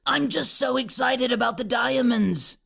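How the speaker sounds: slightly toned-down high witch voice; witch's inside voice. A narrator's reading. Witch apologises to Oxwald, excited in her inside voice. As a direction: still high and eager, without shrieking. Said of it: "now using her inside voice".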